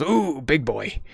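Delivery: put-on drawn-out high ooh, then big boy; mock-impressed coo, pitch swooping up then dropping; imitating wrestler. Commentator imitating Lesnar's reaction to a huge opponent, mock-impressed. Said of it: "He goes".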